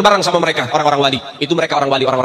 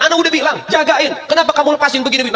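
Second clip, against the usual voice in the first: higher, scolding voice; scolding husband; 8 semitones higher than usual. Acted. Saint scolds his wife for letting the child in, higher. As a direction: scold her sharply from up high.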